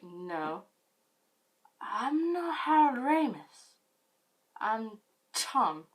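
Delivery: flustered denial from the man; a hint of a put-upon guy; mock Tom. Tom answering drunk narrator.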